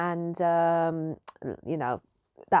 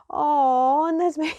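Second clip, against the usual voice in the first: high, loud drawn-out "aww"; long, raised collective coo; audience's cooing reaction; 7 semitones higher, 6 dB louder than usual. Retold or imitated. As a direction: imitate a crowd going aww. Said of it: "the whole audience just went into".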